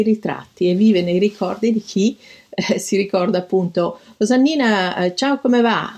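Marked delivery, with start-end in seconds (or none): 4.2-5.9 a bright, higher cheerful hello; cheerful greeting. Retold or imitated. The painter greets young Rosannina, with cheerful higher pitch. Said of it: "detto con allegria".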